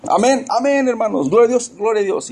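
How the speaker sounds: high, fast mock amen-saying believer; gullible churchgoer. Preacher mocking a churchgoer who just agrees, with high, eager amens. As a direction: parody a congregant swallowing every word. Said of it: "Y decimos".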